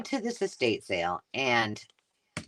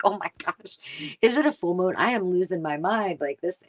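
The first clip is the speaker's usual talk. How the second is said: higher, mock disbelief; exasperated; 6 semitones higher than usual. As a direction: act out losing your mind over it. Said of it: "And I'm like".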